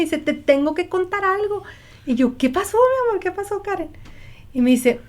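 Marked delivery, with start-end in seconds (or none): 0.2-1.6 put-on excited child. Child's excited words retold. 2.4-3.9 high, tender worried question; mock-worried mother, higher voice; concerned mother. Mother's worried reply retold.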